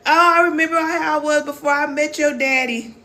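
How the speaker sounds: high mock whine of a mother missing her old life; mocking whiny mom. Mocking moms who miss their pre-baby bodies, high whiny voice. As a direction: put on a high, silly voice to mock a mom stuck on the past.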